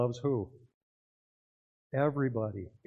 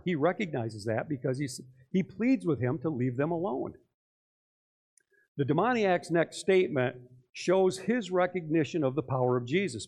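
ordinary talk

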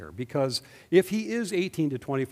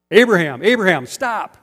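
loud, commanding call to halt; urgent shout; 6 semitones higher, 14 dB louder than usual. Retold or imitated. The angel stops Abraham from sacrificing his son, loud urgent shout. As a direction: yell his name to stop the blade.

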